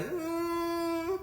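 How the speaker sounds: ordinary talk, nothing marked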